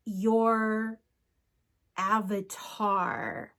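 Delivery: avatar drawn out very slowly and weighted, after a pause; heavy emphasis on avatar. Shown as a demonstration. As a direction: hang on your, then land avatar slowly with weight. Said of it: "heavy emphasis on the word avatar".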